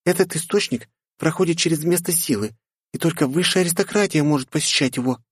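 put-on high aristocratic young lady; haughty girl voice. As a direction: speak with lofty pride. Priscilla continues. (высокий голос аристократки)

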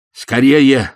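loud urgent shout. A narrator's reading. Ippolit shouts to hurry to the auction. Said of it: "закричал Ипполит Матвеевич".

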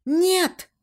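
high, urgent cry of refusal. A narrator's reading. Heroine crying out as she runs to them, high cry. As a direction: shout it out, pitched well up. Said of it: "крикнула я".

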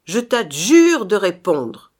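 loud, forceful adjuration; solemn command. A narrator's reading. Brother orders the phantom to answer, loud and commanding.

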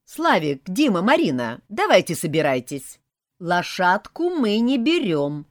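put-on loud, firm round-up of the kids; bossy aunt. A narrator's reading. Aunt dressing the children to leave, bossy. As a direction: order the children out, no arguments.

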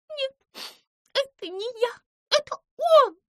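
high squeaky voice, protesting; a small mouse pointing the blame elsewhere; flustered mouse. A narrator's reading. Pimpochka denies it.

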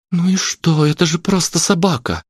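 put-on high-pitched girl's voice; interceding girl. A narrator's reading. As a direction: speak up for the dog in a high voice. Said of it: "вступилась Аня".

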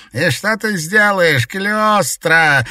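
high, loud challenging shout; defiant shout. A narrator's reading. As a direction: shout it out as a dare. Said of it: "с вызовом выкрикнул старик".

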